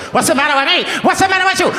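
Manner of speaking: very high, hammed-up Italian accent; mock Italian accent. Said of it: "not sure where the Italian comes from".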